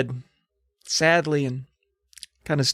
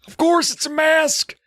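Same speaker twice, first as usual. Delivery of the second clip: put-on high, strained old man voice. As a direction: croak it out like a frail old man. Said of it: "Steve's like".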